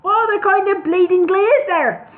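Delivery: indignant retort. Acted.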